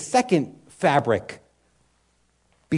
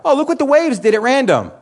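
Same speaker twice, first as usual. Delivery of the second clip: high, louder fake amazement; mock naive wonder; 8 semitones higher, 6 dB louder than usual. Pastor mocking a castaway reading the sand, fake wonder. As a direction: play the fool who sees no author.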